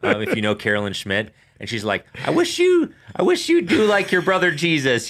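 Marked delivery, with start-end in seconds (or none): 2.3-5.1 Mary impersonation. Acted.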